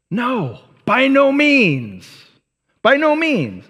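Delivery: slow and forceful, lean hard on each "no"; emphatic denial. Retold or imitated. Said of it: "it's a very emphatic phrasing".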